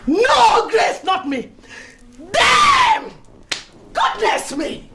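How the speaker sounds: sudden outraged shouts; a betrayed club lady erupting in fury; furious shout. Acted. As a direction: explode at the name, shrieking Damn. Outburst of rage at Grace.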